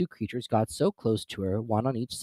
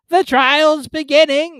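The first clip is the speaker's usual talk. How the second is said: slow, high, loud call from afar; a far-off, drawn-out high shout; distant cry; 12 dB louder, slower than usual. A narrator's reading. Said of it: "heard in the distance".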